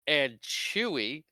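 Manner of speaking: "Chewie" drawn out slowly; dragged-out, emphatic "Chewie" to correct an omission; pointed stress on Chewie. Real, not acted. Co-host adding the forgotten Wookiee to the heroes, pointed "Chewie".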